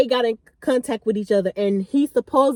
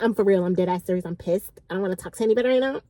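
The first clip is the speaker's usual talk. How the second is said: annoyed friend; 5 semitones lower, faster than usual. Retold or imitated. Pissed-off friend refusing to talk, low and serious. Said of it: "She was like".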